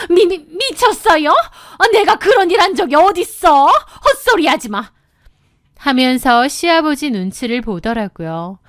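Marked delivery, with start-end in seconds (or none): 0.1-4.9 stammering, high-pitched denial; flustered, raised voice. A narrator's reading. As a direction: deny it all in a high, rattled voice. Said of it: "당황하시면서 목소리를 높이시는". (당황해서 더듬으며 발끈하는 시어머니)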